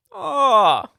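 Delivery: put-on high-pitched, over-the-top Australian accent; Dumb and Dumber Australian impression. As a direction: play a dopey movie quote in hammed-up Australian. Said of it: "the beginning of Dumb and Dumber".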